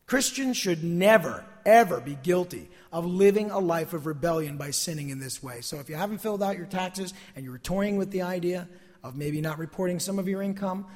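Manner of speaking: ordinary delivery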